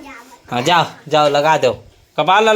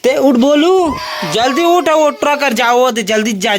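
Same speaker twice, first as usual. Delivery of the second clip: high, loud hurrying orders; urgent call; 7 semitones higher, 6 dB louder than usual. Real, not acted. Urging Bolu to get up fast, high loud calls. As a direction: push him to move, quick.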